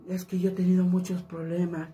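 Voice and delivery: soft lovesick [name]. Shown as a demonstration. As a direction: sigh like a heartbroken singer. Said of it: "el [name] habla así".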